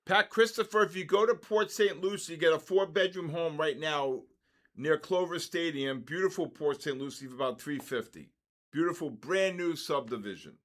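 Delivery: loud, high sales hype; excited pitch. Real, not acted. Calling out to viewer Pat Christopher about homes, loud hype. Hyping a home deal to viewer.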